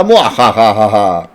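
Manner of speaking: loud, slow evil villain laugh; villain cackle. Retold or imitated. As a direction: cackle like a sixties Batman villain. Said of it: "Like a nineteen sixties Batman villain".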